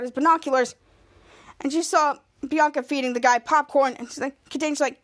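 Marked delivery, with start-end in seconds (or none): none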